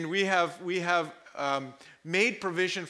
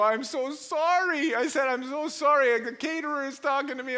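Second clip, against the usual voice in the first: mock flustered apology; 7 semitones higher, 3 dB louder than usual. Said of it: "I go".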